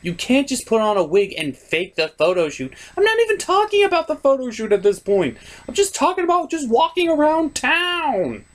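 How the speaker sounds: put-on girly falsetto voice. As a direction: argue with him in a high falsetto lady voice. Falsetto second voice argues back.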